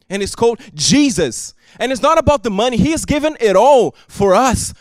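normal talking voice

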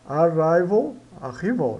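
"Arrival" with the I said "ai", "arráival"; teacher modelling "Arrival"; pronunciation demo. Shown as a demonstration. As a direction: say "Arrival" as a-RAI-val. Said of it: "O I tem som de I".

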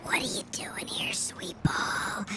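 soft whispered ASMR greeting; ASMR whisper for a viewer. Pretend. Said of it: "wants to listen to some A S M R".

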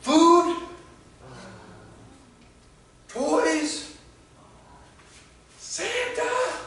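put-on eager little kid. Pastor voicing the boy at the van window, high and eager. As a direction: ask like a hopeful small boy. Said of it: "he looks at me and he goes".

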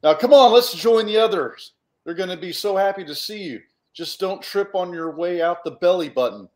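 rally them cheerfully, almost shouting; Cluck calling out. Acted. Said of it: "And then Cluck says".

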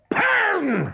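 very high, outraged shout. A narrator's reading. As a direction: shout the enemy's name. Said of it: "shouted Hook".

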